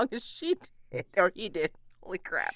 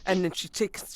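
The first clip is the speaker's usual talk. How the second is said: ordinary talk, nothing marked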